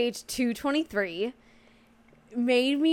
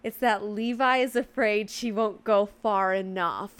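ordinary talk, nothing marked

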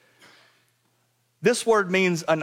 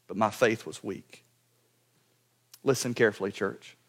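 hushed appeal; 8 semitones lower, 6 dB quieter than usual. Real, not acted. Preacher drops to intimate appeal.

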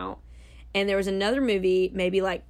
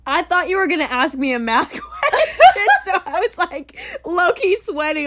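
laughing, higher and louder; 9 semitones higher, 8 dB louder than usual. Real, not acted. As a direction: talk through laughter. Laughing after the pun.

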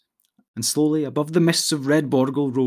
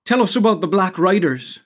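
higher, louder, urgent request; eager interruption; 5 semitones higher, 6 dB louder than usual. A narrator's reading. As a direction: burst in eagerly, pitched up. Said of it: "interrupted Pippin eagerly".

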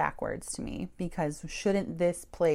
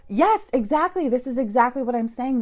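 high, loud eager agreement; excited yes, much higher and louder; amped up; 7 semitones higher, 8 dB louder than usual. Retold or imitated. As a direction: burst out like you finally feel heard. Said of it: "I was so amped up".

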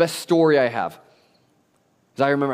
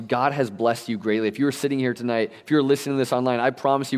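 normal talking voice

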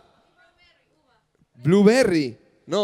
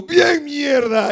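high, loud, drawn-out cheer; hammed-up yell, playing yourself; retold outcry; 9 semitones higher, 6 dB louder, much slower than usual. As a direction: shout it out long and high. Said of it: "Y yo".

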